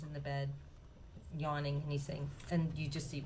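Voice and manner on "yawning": usual speaking voice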